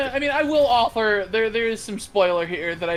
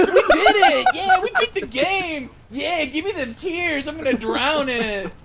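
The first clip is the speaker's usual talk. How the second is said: mock triumph; 6 semitones higher than usual. Parents dancing in daughter's tears, mock gleeful cheer. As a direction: celebrate like you beat the game. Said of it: "Just literally like".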